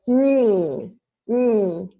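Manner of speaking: sustained demonstration "mm"; nasal sound demo. Shown as a demonstration. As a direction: hum "mm" drawn out from the palate. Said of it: "right on the top of your palette".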